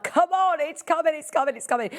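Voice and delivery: high, eager urging at sprouting daffodils; eager coaxing. Retold or imitated. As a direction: cheer the flowers on, excited. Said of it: "and I'm like".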